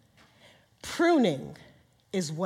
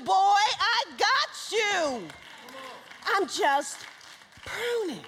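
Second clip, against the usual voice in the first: higher, ringing preacher's call; preaching at full force; 11 semitones higher, 4 dB louder than usual. Shown as a demonstration. Preacher urging the congregation again, loud and higher. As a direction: declare it boldly to the room. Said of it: "now do it again".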